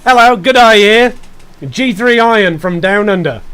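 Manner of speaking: loud, hammy Australian-style greeting; badly faked Down Under accent. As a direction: call out hello in a broad mock Aussie accent. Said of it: "the accent is not strong with me".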